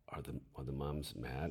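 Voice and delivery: hushed, unvoiced anxious question; whispered worry. A narrator's reading. Nervous young teacher asking his colleague about the mothers, anxious whisper. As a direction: whisper it for fear of being overheard.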